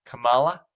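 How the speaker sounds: "Kamala" said kuh-MAH-la, stress moved off the first syllable; mispronounced Kamala. Retold or imitated. Host quoting an intentional mispronunciation, "Kamala" as kuh-MAH-la. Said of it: "intentionally mispronouncing her name".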